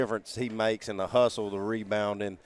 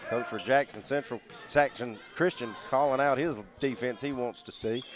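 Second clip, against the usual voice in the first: usual speaking voice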